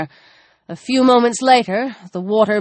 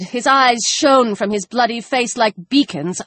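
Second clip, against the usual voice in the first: ordinary delivery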